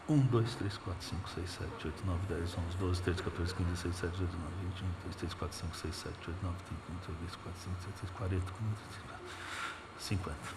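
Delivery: soft, hurried counting under the breath; rapid muttered counting. Shown as a demonstration. Preacher demonstrating counting the crowd, hushed rapid count. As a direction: rattle off the numbers quietly. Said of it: "Chegamos no cem já".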